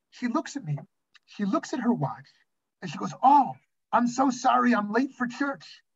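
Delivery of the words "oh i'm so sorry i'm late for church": higher-pitched mock apology, slowing at the end; lightly acted apologetic woman; imitated churchgoer. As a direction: put on a passer-by's drawn-out excuse. Voices the cyclist's breezy excuse.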